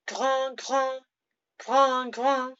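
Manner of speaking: high, loud snorting grunts; pig grunts. Shown as a demonstration. Teacher grunting like a pig for students.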